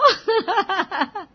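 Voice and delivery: high, giggling peal of laughter; laughing out loud. A narrator's reading. Riya laughing at her own curtain joke, giggling. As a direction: burst out laughing at your joke. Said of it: "इतने जोर से हंसता".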